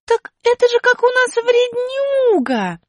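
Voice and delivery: высоко и медленно, осенило; вдруг догадалась; догадка. Acted. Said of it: "догадалась Аля". (высокий голос внезапной догадки)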